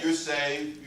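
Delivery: ordinary talk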